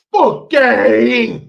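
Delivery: very loud, high, slow Italian-accent yell; bad Italian accent. Shown as a demonstration. Demonstrating for mirror practice, bad Italian accent yell. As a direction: belt it out in a hammy Italian accent. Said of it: "Practice that. Get in the mirror".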